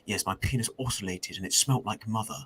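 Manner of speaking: soft, prim upper-class English, the Prince retold; lightly sent-up plummy British delivery; mimicking the Prince reading his book, posh British; mock posh English prince. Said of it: "imagine you're telling someone a story".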